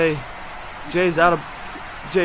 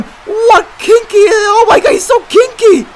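mock shocked; 11 semitones higher, 5 dB louder than usual. Mock-outraged commentary on choking.

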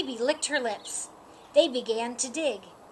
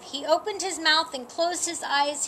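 plain reading voice

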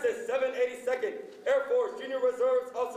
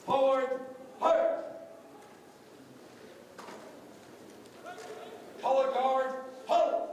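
sharp, drawn-out military command calls; drill commands; 5 dB quieter, slower than usual. Acted. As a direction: call each command crisply to the squad.

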